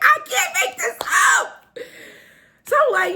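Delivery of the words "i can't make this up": high shout through laughter; squealed, laughing exclamation; laughing disbelief. Real, not acted. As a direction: crack up in sheer disbelief. Amazed at her own story.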